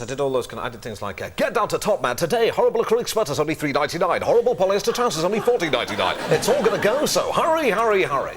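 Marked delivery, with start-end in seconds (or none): 1.4-8.4 high, loud hard-sell advert voice; shouty voiceover building in pitch to the end; radio commercial. Shown as a demonstration. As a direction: belt out a cheesy shop promotion. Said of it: "loads of radio commercials".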